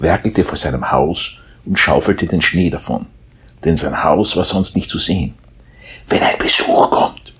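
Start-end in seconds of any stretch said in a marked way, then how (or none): 6.1-7.3 happy mole. A narrator's reading. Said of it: "schnüffelte er fröhlich".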